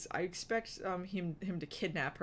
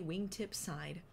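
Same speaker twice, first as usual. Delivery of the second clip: usual speaking voice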